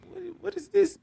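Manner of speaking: mock bafflement, very high-pitched; dumbfounded. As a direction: imitate someone lost for words, voice way up high. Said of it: "he just looked at me dumbfounded".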